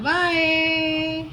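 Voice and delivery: long, slow, high bye. Real, not acted. Mother says a long, slow, high bye to her giggling baby.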